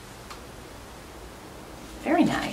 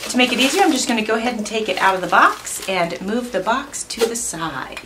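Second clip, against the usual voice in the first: ordinary delivery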